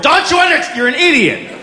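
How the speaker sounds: high-pitched exasperated rant; exasperated parent. Acted. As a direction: lose patience with a toddler, voice shooting up. Mock rant at a toddler.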